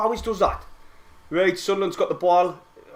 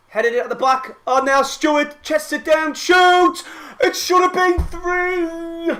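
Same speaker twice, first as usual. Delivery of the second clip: rising to a high, loud yell on the shot; building from excited call-up to a yelled Shoots; excited commentary; 11 semitones higher, 5 dB louder, slower than usual. Real, not acted. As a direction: explode as he shoots. Live chance, shot and miss.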